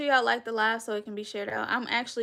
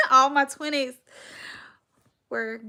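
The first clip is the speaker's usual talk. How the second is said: laughing; 4 semitones higher, 10 dB louder than usual. Real, not acted. Laughing about her twenties.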